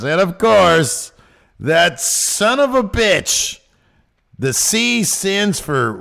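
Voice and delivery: high, loud, slow mock insult; hammy fake outrage at a friend.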